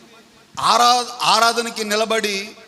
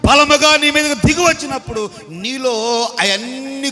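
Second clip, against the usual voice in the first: raised, urgent sermon chant; fervent preaching; 6 semitones higher, faster than usual. Real, not acted. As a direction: preach it higher, in a driving rush. Preaches with rising fervour. (ఆవేశంగా ప్రసంగం)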